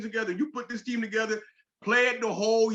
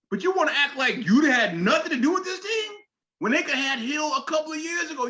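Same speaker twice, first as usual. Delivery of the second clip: angry rant at LeBron; 6 semitones higher, 3 dB louder than usual. Acted. Ranting at LeBron in address.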